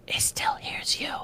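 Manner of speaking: hushed, breathy whisper, barely voiced. Creature backing away, whispering a warning. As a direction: drop to a wary whisper so it can't hear. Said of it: "he backs up a couple feet".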